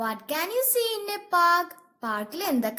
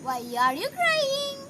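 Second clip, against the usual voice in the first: dewdrop asking; 8 semitones higher than usual. Acted. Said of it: "ഡ്യൂഡ്രോപ്പ് സെന്നിനോട് എന്താ ചോദിച്ചത്?".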